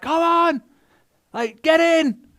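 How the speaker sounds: high urgent call to come; rescuer calling. Retold or imitated. Helicopter rescuer calling to swimmers, high and urgent. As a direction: shout them up the ladder. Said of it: "a guy with a helmet is kinda like".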